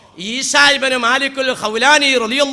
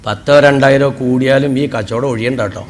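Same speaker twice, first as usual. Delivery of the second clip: lower, sly dealer's sales pitch; cattle broker; 13 semitones lower than usual. Retold or imitated. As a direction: talk the buyer into the deal, pitched well down. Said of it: "അപ്പോൾ broker പറയേ".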